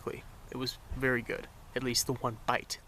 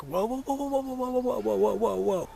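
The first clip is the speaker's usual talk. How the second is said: high, louder string of whoas; frantic high whoa whoa whoa; alarmed whoa; 10 semitones higher, 3 dB louder than usual. Retold or imitated. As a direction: stop him mid-grab, rising panic. Kid reaches for narrator's bread.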